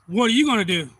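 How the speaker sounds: retold confrontational holler; imitated challenge. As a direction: throw it out like a man picking a fight. Retold stranger challenges the speaker.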